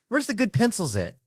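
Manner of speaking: high-pitched, eager hunt for pencils; excited would-be writer. Acted. Comic acting himself out hunting for pencils, high-pitched and eager.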